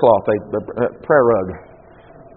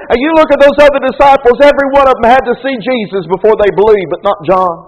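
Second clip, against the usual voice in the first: high, loud, driving sermon voice; full-force preaching; 7 semitones higher, 6 dB louder than usual. Acted. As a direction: preach it hard to the congregation.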